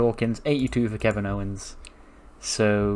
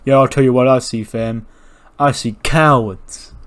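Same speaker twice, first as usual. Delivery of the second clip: trash talk; 6 dB louder than usual. Retold or imitated. Tez taunting AJ and Omos, calling them cowards.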